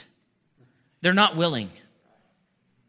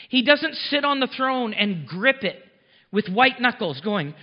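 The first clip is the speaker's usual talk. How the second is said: ordinary delivery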